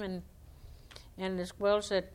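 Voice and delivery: ordinary delivery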